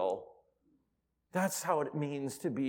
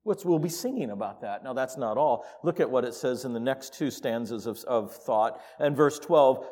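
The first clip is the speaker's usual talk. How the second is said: ordinary talk, nothing marked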